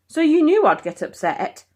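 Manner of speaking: loud, high, cutting question; icy accusation. Acted. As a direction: turn his words against him. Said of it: "Her voice chilled like liquid nitrogen".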